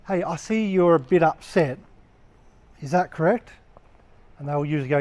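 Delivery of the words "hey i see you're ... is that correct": calm and caring. Shown as a demonstration. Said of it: "You go to them and say".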